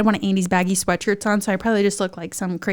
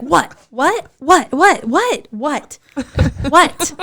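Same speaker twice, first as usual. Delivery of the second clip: mock bewildered, pitched-up short whats; baffled what; 5 semitones higher, much slower than usual. Husband hearing news half-blind, baffled. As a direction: imitate a confused husband, rising.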